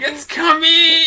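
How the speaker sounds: high, drawn-out manic scream; screaming Nick Cage. Retold or imitated. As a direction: scream it out at full tilt, stretching the words. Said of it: "motorcycle screaming".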